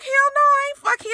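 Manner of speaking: high, protesting retold denial; mock indignant denial. Accused woman denying she slept with him, mock indignant. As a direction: pitch it way up in mock offense.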